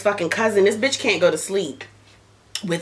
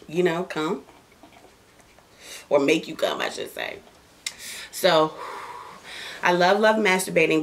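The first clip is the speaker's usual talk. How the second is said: ordinary talk, nothing marked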